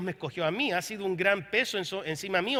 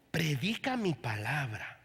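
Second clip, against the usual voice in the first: lower, softer, slow preacher's voice; imitating Paul Crouch; 5 semitones lower, 6 dB quieter, slower than usual. Preacher recalls the evangelist's words, hushed and slow. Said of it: "yo oí la voz de Paul Crouch".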